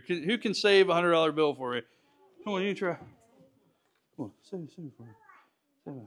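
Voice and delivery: ordinary talk, nothing marked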